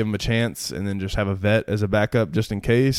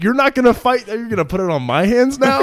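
high, loud and laughing; can't believe he'd follow them; incredulous; 14 semitones higher, 7 dB louder than usual. Retold or imitated. Retelling her alarmed reply.

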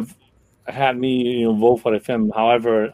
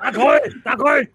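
high, silly mimicking voice; mock high voice; 12 semitones higher than usual. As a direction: squeak it out mockingly. Mimicking flubbed Cantonese line.

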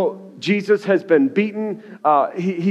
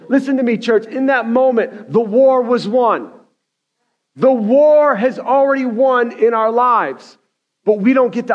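raised, high-pitched sermon delivery; impassioned preaching; 6 semitones higher, 4 dB louder than usual. Real, not acted. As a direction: drive the message home to the church. Preaches at full force.

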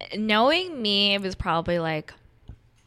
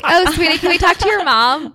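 high, louder mock sweet talk; condescending adult; 9 semitones higher, 9 dB louder than usual. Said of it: "They're like".

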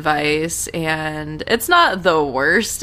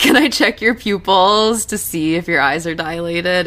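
imitating J J. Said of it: "and he's like".

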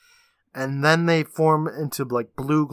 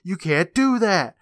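higher, slow imitation of a yelling kid; mock yell; 5 semitones higher, 4 dB louder, slower than usual. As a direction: draw out the kid's protest. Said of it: "as the kid yells".

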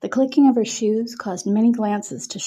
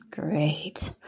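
sighing; 3 semitones lower, 6 dB quieter than usual. A narrator's reading. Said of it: "Cody sighed".

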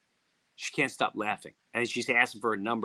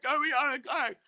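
retold panic, less loud; 12 semitones higher than usual. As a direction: repeat the frantic plea with less force. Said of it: "she goes in full-blown panic".

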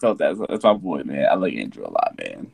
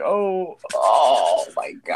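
slow, drawn-out awe; mock stunned reaction; awed drawl; 2 semitones lower, 4 dB quieter, much slower than usual. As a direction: stretch it out in disbelief. Retold awe.

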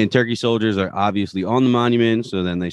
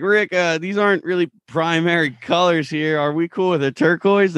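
Morty; 9 semitones higher than usual. Acted. Acts Morty unsure about wires.

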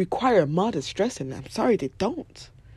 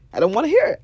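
louder, pitched up on hear; louder insistence; 4 semitones higher, 5 dB louder, faster than usual. Shown as a demonstration. Refusing to hear bad news, louder and pitched up. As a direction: push it higher and louder.

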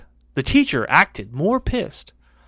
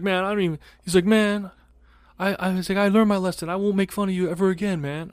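put-on begging kid; 5 semitones higher, 4 dB quieter than usual.

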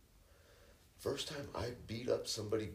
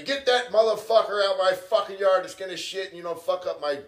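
loud, high yell at the son; dad yelling; 8 semitones higher, 8 dB louder than usual. Retold or imitated. Dad yells at his son to get the dog out, a loud mock yell. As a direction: bark the order from the yard, higher and louder. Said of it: "my dad's yelling at me".

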